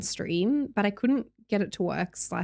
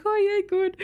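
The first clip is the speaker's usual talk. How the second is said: perky reply; 10 semitones higher than usual. Retold or imitated. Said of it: "I was like".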